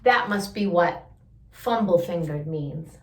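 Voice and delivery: ordinary delivery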